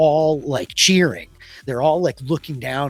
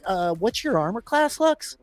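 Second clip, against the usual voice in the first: usual speaking voice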